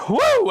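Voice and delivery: high whooping cheer; excited woo. Real, not acted. Host cheering a viewer's home state, high excited woo. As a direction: let out a happy high woo.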